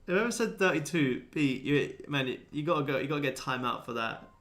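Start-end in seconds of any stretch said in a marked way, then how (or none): none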